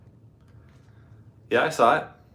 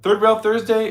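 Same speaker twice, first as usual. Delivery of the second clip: big show intro, pitched up; announcer voice; 9 semitones higher, 6 dB louder than usual. Real, not acted. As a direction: announce the show title with gusto. Show title announced loudly.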